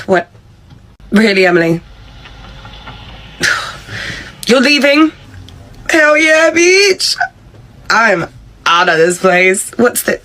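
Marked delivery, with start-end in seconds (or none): 4.5-5.1 shocked. Acted. Karen shocked employee quits. 5.9-7.2 high, gloating whoop; gleeful cheer. Acted. Emily gleefully quitting on boss.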